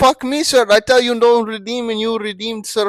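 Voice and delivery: put-on shouting in an Indian accent; angry Indian accent. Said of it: "you also have an Indian accent".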